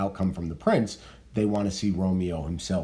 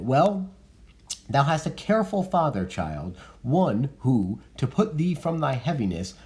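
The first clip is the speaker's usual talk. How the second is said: reading Lady Capulet; 6 semitones higher than usual. Acted.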